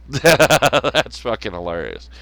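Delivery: slow, laughing through the words. Real, not acted. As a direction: say it while really cracking up. Laughs while repeating it himself.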